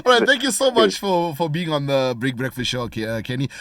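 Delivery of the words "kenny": ordinary delivery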